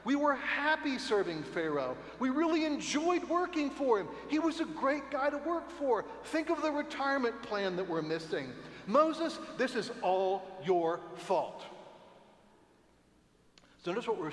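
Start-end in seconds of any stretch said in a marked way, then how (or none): 0.0-11.6 high, loud mock nostalgia for Pharaoh; hammed-up grumbling Israelites blaming Moses; sarcastic whining crowd. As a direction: pretend whiny complaint. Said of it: "turn to Moses and say".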